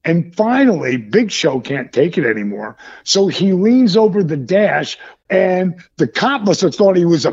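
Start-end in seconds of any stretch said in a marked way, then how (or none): none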